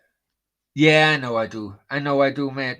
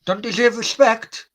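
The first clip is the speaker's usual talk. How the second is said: higher and louder, outraged disbelief; incredulous echo; 8 semitones higher, 6 dB louder than usual. Real, not acted. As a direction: throw the words back, stunned.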